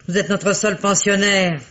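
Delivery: ordinary delivery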